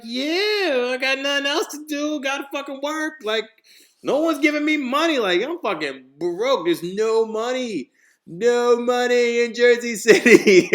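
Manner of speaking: high, loud desperate wail; exasperated inner rant. Acted. Broke young man's unspoken answer to mom, exasperated wail. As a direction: wail it out, stretching to the end.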